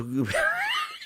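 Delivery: high shrieking laugh; burst of laughter. Real, not acted. Narrator losing it mid-story, shrieking laugh. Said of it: "I can't keep a straight face".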